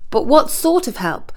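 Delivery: ordinary delivery, nothing marked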